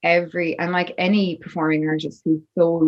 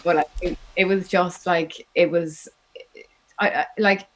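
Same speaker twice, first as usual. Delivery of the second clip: holding back tears. Real, not acted. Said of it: "I'm not gonna start crying".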